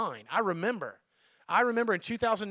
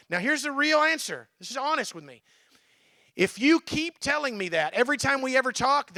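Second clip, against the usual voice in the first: usual speaking voice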